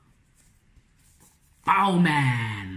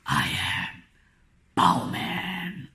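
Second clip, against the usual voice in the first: Batman-style voice; much slower than usual. Shown as a demonstration. Author reading the hero's line like Batman. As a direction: announce yourself gravely. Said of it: "read it in that voice".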